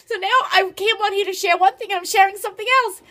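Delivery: mother wrapping up the video, overjoyed and teary; giddy joy. Real, not acted. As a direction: sign off still bubbling with pride. Still overjoyed after the news.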